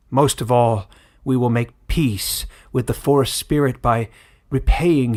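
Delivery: put-on loud, grave old village elder addressing the crowd; solemn elder's address. Old elder announcing the verdict to villagers, loud and grave. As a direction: proclaim it like a weary old elder passing judgment. Elder continues his proclamation.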